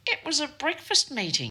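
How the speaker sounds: high-pitched, incredulous retort; retold squeak of disbelief; indignant protest. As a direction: protest as if it's absurd.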